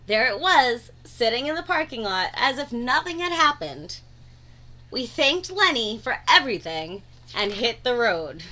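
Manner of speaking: ordinary delivery, nothing marked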